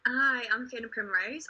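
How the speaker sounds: ordinary talk, nothing marked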